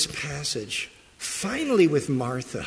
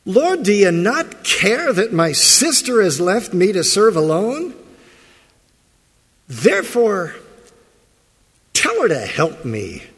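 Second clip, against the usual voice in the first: louder, higher indignant outburst as Martha; blurted complaint; 5 semitones higher, 6 dB louder than usual. Retold or imitated. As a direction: hurl the complaint at the Lord like a frustrated sister. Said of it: "blurts it out".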